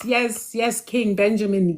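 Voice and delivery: ordinary delivery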